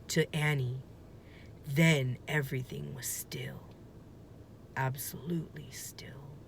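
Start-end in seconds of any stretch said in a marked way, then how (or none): 1.7-6.4 whisper. A narrator's reading. Sudden calm after the spin.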